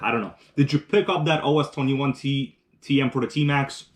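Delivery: usual speaking voice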